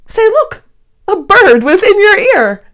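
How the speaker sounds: storybook gasp of discovery; surprised discovery. Acted. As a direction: point it out in astonishment. Discovering a bird in the ear.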